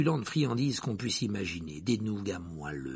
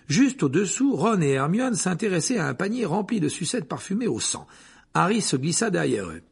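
ordinary delivery, nothing marked

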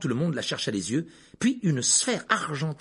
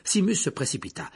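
ordinary delivery, nothing marked

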